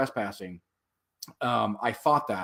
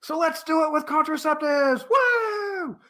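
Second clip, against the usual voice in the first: high-pitched heckle from the crowd; high, drawn-out mock cheer; retold yell; 3 dB louder, slower than usual. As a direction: holler it out with a rising whoop. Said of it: "And so I yell out".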